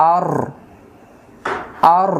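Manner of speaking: আর with rounded lips and a heavy, thick r; full, round-mouthed আর. Shown as a demonstration. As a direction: say আর with the mouth rounded, closer to "awr". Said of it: "মুখটা গোল হবে".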